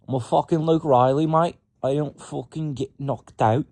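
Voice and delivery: brash fighter boast in a bad British accent; botched British accent. Voicing fighter Luke Riley, bad British accent boast. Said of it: "I keep saying Australian shit, but trying to do it in a British accent".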